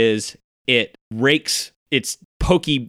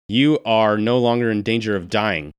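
usual speaking voice

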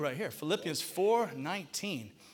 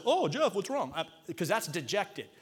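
ordinary delivery

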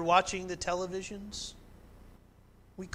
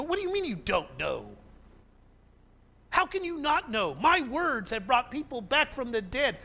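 Jesus exasperated; 7 semitones higher than usual. Acted. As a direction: rise into an urgent rebuke. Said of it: "you can hear Jesus go".

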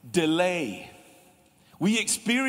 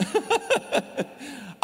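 laughing; 8 semitones higher than usual. Real, not acted. Laughs at own gesture.

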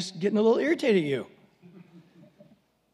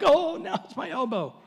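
higher mock little kid's complaint; whiny child; 8 semitones higher than usual. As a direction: kid milking another tiny scrape. Child back with another scratch.

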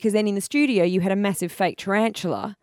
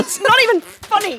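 indignant protest; 13 semitones higher than usual. Real, not acted. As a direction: insist it isn't funny, upset. Protesting after spider prank replay.

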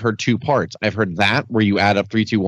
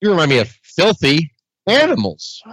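higher, slow, mock-earnest interviewer; Mean Gene impression; 6 semitones higher than usual. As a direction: voice the old interviewer, drawn out.

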